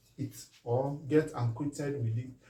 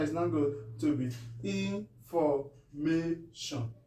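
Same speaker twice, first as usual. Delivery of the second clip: slow teaching line; 4 dB quieter, slower than usual. Shown as a demonstration. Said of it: "If you pronounce them together".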